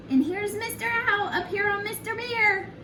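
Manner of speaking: usual speaking voice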